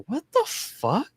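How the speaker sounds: high, slow mock bafflement; baffled reaction. Viewer retelling his reaction to the soap bar bit, baffled. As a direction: stretch it out high in disbelief.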